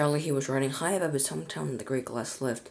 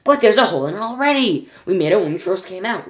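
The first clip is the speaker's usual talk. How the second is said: higher, louder call; shouting; 6 semitones higher, 8 dB louder than usual. A narrator's reading. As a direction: shout over the roar. Charlie shouting over noise.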